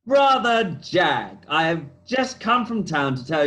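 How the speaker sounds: high, very drawn-out salutation; theatrical greeting. Acted. Pretended brother hails Jack on arrival, theatrical greeting. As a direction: stretch the greeting out with a flourish.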